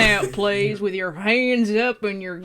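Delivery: hammed-up Texan lawman; Texan accent. Shown as a demonstration. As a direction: play it as a joking Texan. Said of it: "do that in a Texan voice".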